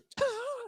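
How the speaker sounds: high stifled giggle. Real, not acted. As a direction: giggle breaking into the sentence. Cracking up over friends' texts.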